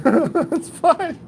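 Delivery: high, strained laughing reassurance; laughing through pain. Shown as a demonstration. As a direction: insist all is well while cracking up. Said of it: "It's like laughing through pain".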